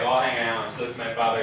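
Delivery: usual speaking voice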